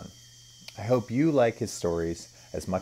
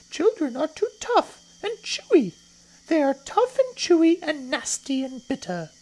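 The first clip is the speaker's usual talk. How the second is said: very high, louder little creature voice; not-so-big crocodile; 7 dB louder than usual. Acted. Not-so-big crocodile arguing children taste bad, high complaining voice. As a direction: complain as a small high-pitched croc.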